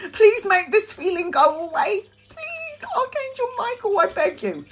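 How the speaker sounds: sudden high, thin begging; high pleading. Retold or imitated. Retelling her panic, she begs the archangel in a high plea. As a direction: plead way up high, almost squeaking.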